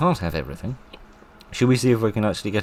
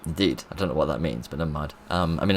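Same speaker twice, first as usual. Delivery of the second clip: normal talking voice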